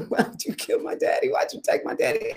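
breathy whispered sob, rising high on the second line; retold crying prayer. Grieving daughter crying to God in her closet, whispered sob. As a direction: relive a broken cry at God. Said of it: "I was in the bottom of my closet crying".

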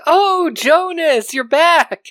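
imitating the wife apologising for the cat food; dotty wife. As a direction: play her as an oblivious cat lady, high and innocent. Wife greeting the bulldog.

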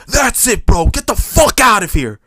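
high, loud mock yell; imitating an old man's furious shout; angry shout. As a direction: bark him out of the seat. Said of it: "This guy's like".

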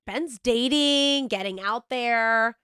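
slow and emphatic. Retold or imitated. Quoting own proud thought, slow.

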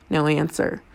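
usual speaking voice

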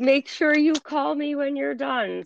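put-on a light, bright take on an eager college kid; eager son. Son's request, retold by mother.

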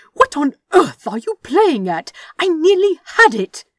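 furious high shout; incensed. Acted. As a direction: scold at full volume, voice climbing.

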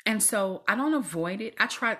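usual speaking voice

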